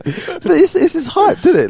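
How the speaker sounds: shouting Friday hype; high hyped excitement. Real, not acted.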